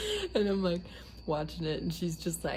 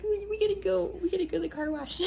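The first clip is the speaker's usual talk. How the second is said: high, eager mom voice, fast; imitating mom; 12 semitones higher, faster than usual. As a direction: insist like an excited mother. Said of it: "she's like".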